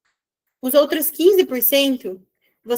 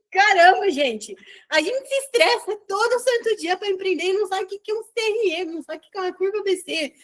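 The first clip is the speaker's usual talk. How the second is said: exasperated rant; 6 semitones higher than usual. Real, not acted. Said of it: "por dentro eu tava bem brava".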